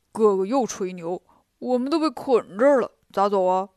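low, doltish pig-man grumble; low-voiced grumbling pig disciple; grumbling Bajie. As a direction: complain as a sulky Bajie, low and full-voiced. 八戒 role in story.

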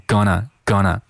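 "gonna" as two syllables, "guh-nuh"; reduced form. Shown as a demonstration. Teacher shows learners the end result, "gonna" fully contracted. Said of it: "久而久之就变成了".

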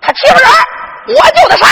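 very high, loud justification; defiant young accused. Acted. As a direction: answer the judge in ringing high shouts. 少年堂上顶答. (少年尖声理直气壮)

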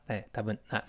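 normal talking voice

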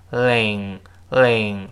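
"ling" slowly, tongue tip touching behind the upper teeth; forward-tongue l. Shown as a demonstration. Teacher demonstrates the hard-to-master l for learners, slow forward-tongue sound. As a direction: draw out "ling" without curling the tongue. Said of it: "注意舌尖往前指".

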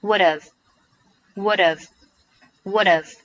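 "would've" said slowly as one joined word; slow teacher demo of "would've", run together; "would've" linked and carefully repeated; linked pronunciation. Shown as a demonstration. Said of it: "What 和have可以连读成".

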